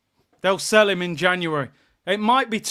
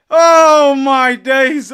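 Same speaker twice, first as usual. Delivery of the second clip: slow, loud oh my days; real exasperation at full volume; drawn-out exasperated shout; 4 semitones higher, 10 dB louder, much slower than usual. Real, not acted. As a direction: groan it out in disbelief. Exasperated at the team.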